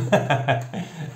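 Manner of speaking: real giggle at himself; short laugh. Real, not acted. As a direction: burst into a quick laugh. Laughs at own silly accent. (petit rire spontané)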